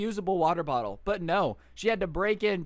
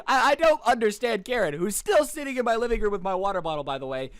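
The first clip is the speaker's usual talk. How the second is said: incredulous; 3 semitones higher, 4 dB louder than usual. Real, not acted. Incredulous aside about Karen.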